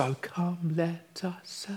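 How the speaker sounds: hushed, whispered carol line. Shown as a demonstration. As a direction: whisper it like a congregation starting softly. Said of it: "I love that we all whisper".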